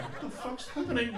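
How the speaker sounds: small high-pitched confused question; mock bewilderment. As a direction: imitate a child stunned by dad's outburst. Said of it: "and you're like".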